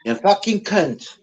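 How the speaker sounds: mock Scottish insult. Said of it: "the other one".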